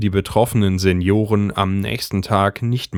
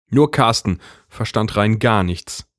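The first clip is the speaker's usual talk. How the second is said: ordinary delivery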